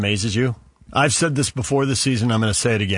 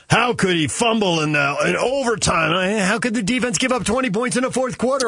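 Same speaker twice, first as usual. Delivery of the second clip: higher-pitched incredulous questioning; mildly acted disbelief at a team's blunders; exasperated complaint; 8 semitones higher than usual. Frustrated fan questions the defense, mildly exasperated.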